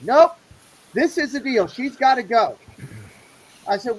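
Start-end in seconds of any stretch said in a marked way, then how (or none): 0.0-2.6 a loud, higher snap of no; sharp loud refusal. Retold or imitated. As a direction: shut him down hard and loud. Retold gatekeeper refusing him.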